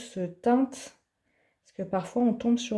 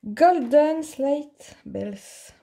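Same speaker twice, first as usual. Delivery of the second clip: "Golden Slate Bells" read very slowly and a bit higher in heavy French-accented English; 4 semitones higher, 4 dB louder, much slower than usual. Real, not acted. Crafter reading a paint name to viewers, slow French-accented English. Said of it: "mon accent est déplorable".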